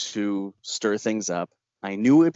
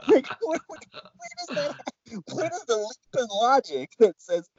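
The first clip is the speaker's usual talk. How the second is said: incredulous; 12 semitones higher than usual. Real, not acted. Indignant rant about threats.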